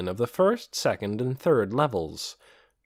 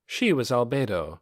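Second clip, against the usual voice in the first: ordinary delivery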